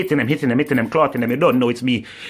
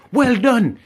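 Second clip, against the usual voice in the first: higher, louder mocking well done; sarcastic praise; 9 semitones higher, 3 dB louder than usual. Real, not acted. Ranting at politicians, scornful sarcastic praise. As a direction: sneer the congratulations. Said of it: "you know say sarcasm".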